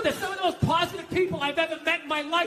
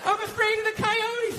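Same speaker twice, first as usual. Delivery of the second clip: higher, lightly voiced excuse from the wife; imitating wife; 5 semitones higher than usual. Wife explaining why she skips the trash, mock innocent. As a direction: play the wife making a lame excuse. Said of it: "She goes".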